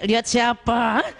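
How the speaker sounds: slow, suspicious wife pinching her husband; wife catching husband looking, slow suspicious jab; jealous wife. Retold or imitated. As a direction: drag it out, mock suspicious. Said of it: "Kita cubit lagi suami".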